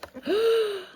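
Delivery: quick high gasp of delight. Real, not acted. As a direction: gasp at the surprise. Gasp at gift.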